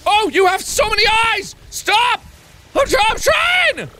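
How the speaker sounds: high frantic yelling; panicked shouting. Real, not acted.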